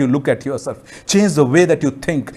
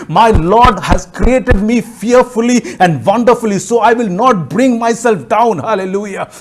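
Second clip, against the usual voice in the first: loud, high, slow emphatic proclamation; full-force preaching; 6 semitones higher, 6 dB louder, slower than usual. Real, not acted. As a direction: drive each declaration home to the congregation, loud and high.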